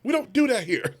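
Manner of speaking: high-pitched imitation of a girl telling people off; mock high reprimand; scolding young girl. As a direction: put on a girl's high scolding voice.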